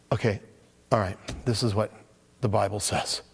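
low, flat, reluctant greeting; irritated. Retold or imitated. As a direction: let the annoyance show as you answer the phone. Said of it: "irritation in my voice".

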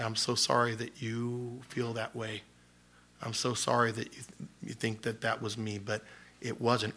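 soft, gentle apology; soft answer. Shown as a demonstration. Answering an angry accuser with a soft gentle apology. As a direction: turn away wrath with a hush.

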